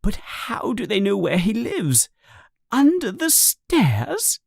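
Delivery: high, hushed anxious questions; worried wife. A narrator's reading. Petunia anxiously asking her husband, high and hushed. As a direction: fret in a hushed voice. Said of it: "says Aunt Petunia".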